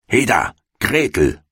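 impatient call. A narrator's reading. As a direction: snap the girl to attention. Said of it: "rief sie dem Mädchen zu".

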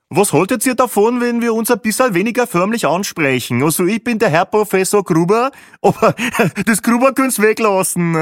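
loud, high jokester voice; self-amused clown with a chuckle; Peppi, jovial bus clown. A narrator's reading. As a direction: announce it high and loud, laughing at yourself.

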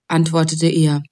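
plain reading voice

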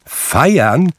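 high, loud, rising question; astonished one-word query; incredulous echo. A narrator's reading. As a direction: throw the word back in disbelief. Nephew echoes the word in disbelief.

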